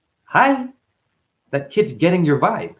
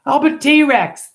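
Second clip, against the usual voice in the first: put-on high, very slow, loud kid roar of a line; child proudly declaring himself a T-Rex; little kid voice; 13 semitones higher, 8 dB louder, much slower than usual. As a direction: stretch it out like a proud toddler.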